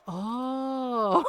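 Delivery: long drawn-out mock "oh"; dawning realisation. Lady learning her mom's Filipino, dawning realisation. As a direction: let the realisation stretch right out.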